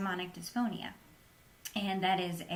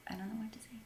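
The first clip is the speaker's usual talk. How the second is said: whisper; 3 semitones higher, 9 dB quieter, much faster than usual. Real, not acted. Unsure how to answer question.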